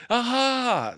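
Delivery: high, loud aha; a poor aha. Acted. Piglet trying to scare Kanga, high loud aha. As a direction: try to sound triumphant and miss.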